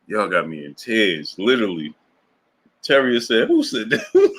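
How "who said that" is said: much higher, giggling through the question; high laughing "who said that"; laughing quote. Real, not acted. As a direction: ask it while breaking up laughing. Reading viewer's quote mid-laughter.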